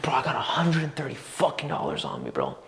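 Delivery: whispered, awestruck self-talk; hushed awe. Retold or imitated. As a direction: whisper it like a thrilling secret to yourself. Awed self-talk about sudden riches.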